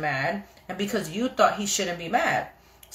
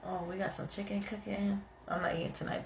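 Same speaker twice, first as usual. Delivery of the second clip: much softer, off-mic voice; quiet aside; 14 dB quieter than usual. Real, not acted. Streamer chatting to household about dinner, quiet aside. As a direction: drop the volume right down for someone nearby.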